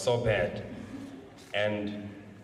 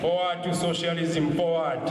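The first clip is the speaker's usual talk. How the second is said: ranting dictator speech; 6 semitones higher, 3 dB louder than usual. Real, not acted. Leading crowd in revolutionary slogans.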